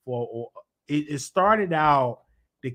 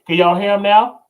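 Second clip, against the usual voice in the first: calling out; 5 semitones higher, 10 dB louder than usual. Real, not acted. Calling out to audience.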